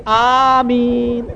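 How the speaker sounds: long drawn-out, ringing Ameen; prayer "Ameen" blurted aloud. Retold or imitated. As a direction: stretch the Ameen out at full voice. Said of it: "میرے منہ سے نکل گئی".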